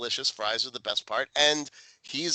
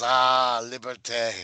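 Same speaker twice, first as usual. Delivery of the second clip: put-on French accent; slower than usual. French chef nodding.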